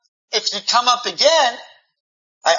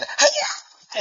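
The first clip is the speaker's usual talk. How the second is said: a high mock kiai, like a kung fu movie; playful high-pitched battle cry; martial arts yell. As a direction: shout it like a cartoon karate move.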